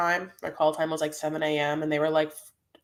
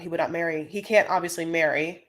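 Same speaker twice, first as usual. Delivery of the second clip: ordinary talk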